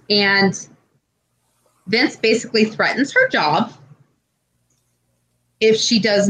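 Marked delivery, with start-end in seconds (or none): none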